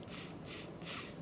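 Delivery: soft unvoiced tss hisses; monkey tsk sounds. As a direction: tut back like a cheeky monkey. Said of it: "the monkeys ... said".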